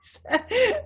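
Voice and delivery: a high burst of laughter; laughing at herself. Real, not acted. Laughing while retelling.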